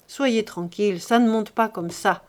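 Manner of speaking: usual speaking voice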